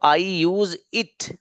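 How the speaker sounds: every word stretched out slowly; slow model sentence. Shown as a demonstration. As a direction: lay the sentence out slowly for students. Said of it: "teacher models sentence slowly". (धीरे-धीरे, हर शब्द खींचकर)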